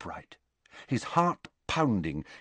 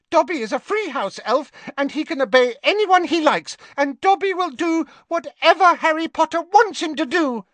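much higher, louder devoted elf cry; tearful Dobby; 5 dB louder than usual. Acted. Dobby swearing loyalty to Harry, high and tearful. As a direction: pour out loyalty through tears. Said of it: "tears now streaming down".

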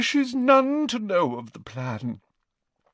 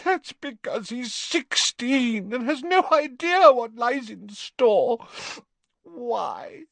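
much higher, tearful pleading; crying in earnest. Acted. Narcissa begs Snape through tears, much higher. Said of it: "began to cry in earnest".

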